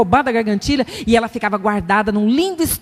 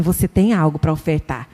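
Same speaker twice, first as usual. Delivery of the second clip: lower, gentle inner voice; inner voice of God; 8 semitones lower than usual. Retold or imitated. The Spirit speaking to her heart, lower and gentle. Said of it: "veio uma vozinha assim dentro de mim e falou assim".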